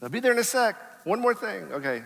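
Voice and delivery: high, whiny put-off; stalling kid. Retold or imitated. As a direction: drag it out like a kid.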